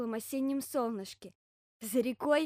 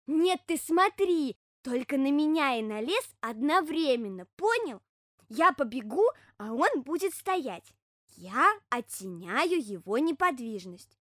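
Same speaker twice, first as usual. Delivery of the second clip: louder, slow hedgehog voice, leaning on key words; earnest hedgehog explaining; 3 semitones higher, 6 dB louder, slower than usual. Acted. Hedgehog instructing bear cub how to watch him, slow and emphatic.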